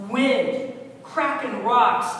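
loud, punched-out words; forceful dramatic preaching. Acted. God showing Elijah his power, forceful dramatic delivery. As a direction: boom out each display of power. Acting out God's show of power.

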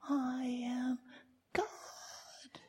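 tiny whispered mouse voice; squeaky mouse whisper. Retold or imitated. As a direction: whisper it like a mouse claiming to be God. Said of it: "sound like a squeaky mouse".

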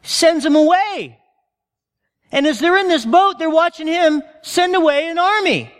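building excitement. Real, not acted. Excited retelling.

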